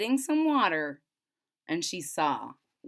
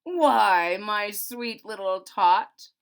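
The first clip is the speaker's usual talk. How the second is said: loud, slow, sugary fake Santa; 9 dB louder, much slower than usual. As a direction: coax the child with a lie. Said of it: "the fake Santa Claus lied".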